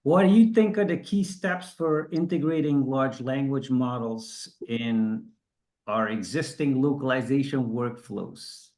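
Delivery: normal talking voice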